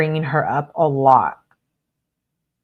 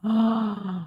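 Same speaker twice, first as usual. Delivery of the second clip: a quick shocked gasp; sharp gasp; 5 semitones higher, 5 dB quieter than usual. Real, not acted. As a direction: catch your breath in surprise. Shocked by the text.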